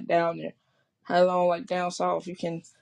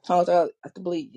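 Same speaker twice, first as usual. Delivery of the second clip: usual speaking voice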